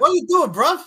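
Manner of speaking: high, loud cockney challenge; mock London accent. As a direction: bark it like a London lad.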